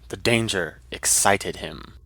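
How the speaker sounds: plain reading voice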